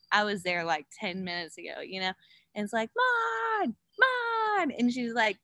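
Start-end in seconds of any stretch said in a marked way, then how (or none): 3.0-4.7 high, drawn-out call; retold calling out. Rider calling to her hunched-over friend, high drawn-out call. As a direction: imitate calling a friend by name.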